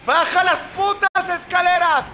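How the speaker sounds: high frantic furious yell; raging gamer. Real, not acted. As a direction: scream it, panicked and angry. Fleeing enemy in game.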